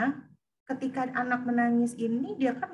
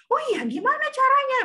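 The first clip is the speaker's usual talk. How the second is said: put-on very high, loud curious kid; a child keen to learn how; eager child voice; 14 semitones higher, 6 dB louder than usual. Child voiced in example dialogue. (suara anak kecil yang antusias, sangat tinggi)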